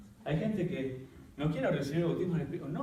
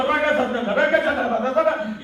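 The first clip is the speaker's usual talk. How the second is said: high, loud ecstatic tongues; speaking in tongues; 10 semitones higher, 11 dB louder than usual. Retold or imitated. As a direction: burst into glossolalia. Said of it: "llorando y hablando en lengua".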